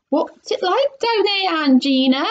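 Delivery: high-pitched call. A narrator's reading. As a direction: call down to someone far below. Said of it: "he called out".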